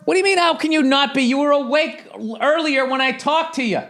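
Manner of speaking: high-pitched exasperated yelling; yelling to wife. Real, not acted. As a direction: raise your voice across the house. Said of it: "screaming at the top of your lungs".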